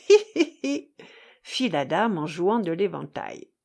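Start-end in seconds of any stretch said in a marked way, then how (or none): 0.1-0.9 high coquettish titter; giggle. Acted. Lady titters playing with her fan.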